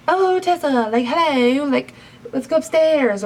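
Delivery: high, sing-song creepy flirting; sleazy come-on. Retold or imitated. Dan luring Tessa upstairs, high sing-song sleazy come-on.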